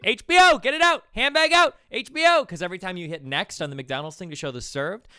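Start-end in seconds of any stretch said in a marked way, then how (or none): 0.0-2.4 loud, high chant of the order call; shouting orders. Retold or imitated. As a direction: yell it across the kitchen like a manager. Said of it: "That's what they'd be shouting".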